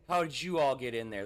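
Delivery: ordinary talk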